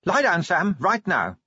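high, commanding order; authoritative. A narrator's reading. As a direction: give an order that brooks no disobeying. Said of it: "in an authoritative voice that brooked no disobeying".

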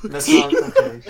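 high, laughing objection; laughing protest. Real, not acted. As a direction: protest while cracking up.

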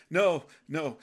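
hammed-up fearful protest; mock panic, pushing love away; panicked refusal. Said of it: "You're like".

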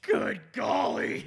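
sudden loud yell into the mic. Real, not acted. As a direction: shout it out loud. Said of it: "I just yelled into the microphone so loud".

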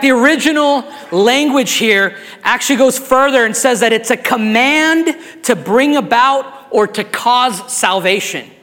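usual speaking voice

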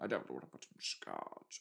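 a hint of a gruff character saying "scotch". Voicing game dialogue twice.

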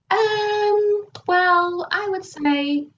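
high, hedging client voice, stalling; hesitant client. Retold or imitated. Client dodging how angry she is, high and hesitant. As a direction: stall like someone dodging the question.

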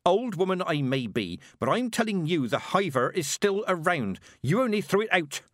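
contained shout, driving the warning home. A narrator's reading. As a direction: insist forcefully in anger. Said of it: "Mistress Weatherwax shouted".

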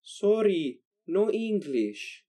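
put-on high, slow foreign accent, halting; broken English. Stranger at the rest stop answers in a high, slow foreign accent.

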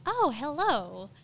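intrigued come-on, stretched out; flirty. Acted. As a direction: greet a sudden advance with slow, playful interest. Flirty reaction acted out to kissing.

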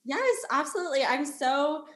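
usual speaking voice

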